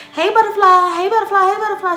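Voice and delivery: neighbours greeting. Retold or imitated. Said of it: "they all saying hi to her".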